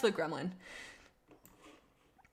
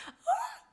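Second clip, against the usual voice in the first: very high squeaky wail; a sudden high overcome squeal; mock emotional wail. Streamer claiming tears over a beloved cat, mock wail. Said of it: "I am emotional".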